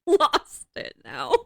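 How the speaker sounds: giggling helplessly; laughing. Real, not acted. Listener cracking up at fumbling.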